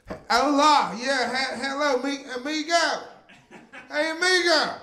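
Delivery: high, loud Southern twang on hola and amigo; hammed-up Southern tourist greeting; Southern gringo Spanish.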